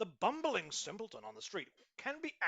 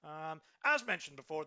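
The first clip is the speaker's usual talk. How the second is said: ordinary talk, nothing marked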